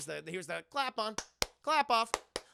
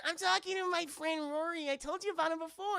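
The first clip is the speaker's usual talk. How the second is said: put-on high child's voice; imitating a daughter explaining her friend to mom; little girl voice; 10 semitones higher than usual. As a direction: answer like a small girl. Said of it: "She's like".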